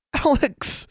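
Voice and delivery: high relieved exclamation; exclaimed. A narrator's reading. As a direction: cry out her name as she wakes. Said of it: "she exclaimed".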